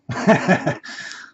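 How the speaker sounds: high, breathy burst of laughter; put-on incredulous laughing fit; acted laugh. A narrator's reading. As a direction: laugh at yourself mid-pep-talk. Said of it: "Laughing for a minute".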